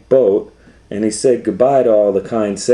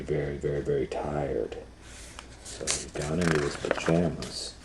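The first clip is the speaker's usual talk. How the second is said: sleepy fade; 5 semitones lower, 14 dB quieter than usual. A narrator's reading. Sleepy bedtime fading.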